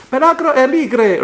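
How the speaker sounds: hammy Italian announcer; mock Italian. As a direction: fake Italian, high and loud. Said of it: "means wider is better in Italian".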